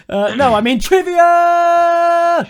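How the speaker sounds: slow, high, loud shout; excited shout. Acted. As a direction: belt it like a game show host. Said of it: "Joe, get excited".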